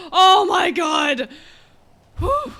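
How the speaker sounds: loud crying shout of relief. Real, not acted. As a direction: gasp and yell it out. Item back, shouting relief.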